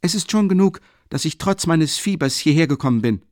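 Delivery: loud, high indignant reply; student angrily defending his fever; angry retort. A narrator's reading. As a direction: flare up with relish. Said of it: "ärgerlich wurde".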